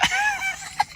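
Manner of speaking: a high, sudden shriek of laughter. Real, not acted. Comic cracks up at his own story, shrieking laugh. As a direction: burst out in a squealing laugh.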